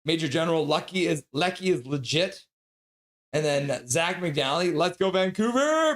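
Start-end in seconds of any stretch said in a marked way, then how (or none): 4.8-6.0 rally the fans; team cheer. Acted. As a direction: let the last word swoop up high. Reading a member's team cheer.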